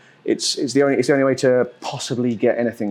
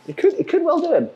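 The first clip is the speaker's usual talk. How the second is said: excited; faster than usual. Real, not acted. Hoping the attack kills.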